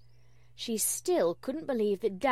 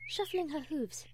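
ordinary delivery, nothing marked

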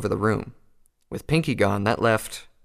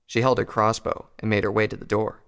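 ordinary delivery

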